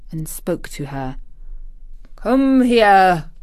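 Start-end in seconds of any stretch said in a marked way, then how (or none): 2.1-3.3 drawn-out, firm summons; troubled guardian. A narrator's reading. Uncle summoning his niece, troubled guardian voice. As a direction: call the child over, stretching the words.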